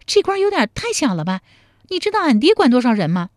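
high, fast heroine; high, pert, dismissive woman; proud young woman. Acted. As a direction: boast of her father in a high voice. Woman character boasts.

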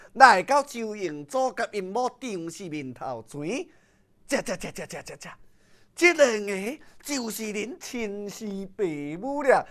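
ordinary delivery

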